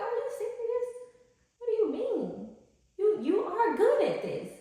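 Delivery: high, scolding disbelief; self chin check. Retold or imitated. Speaker chin-checking herself to viewers, high and scolding. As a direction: talk yourself out of doubt, incredulous. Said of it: "I have to chin check myself".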